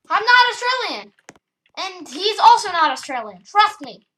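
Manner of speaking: loud, high-pitched protest; insistent shout. Pretend. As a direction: belt out the denial to convince. Repeated insistent denial.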